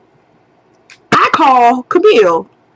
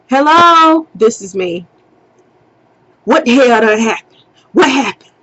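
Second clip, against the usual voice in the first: mom demanding answers; 3 semitones higher than usual. Retold or imitated. Mom calls upset about the fight.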